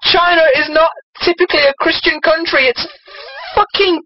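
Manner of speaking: high, incredulous protest; exasperated rant. Acted. Reader objects to a church story in China, exasperated.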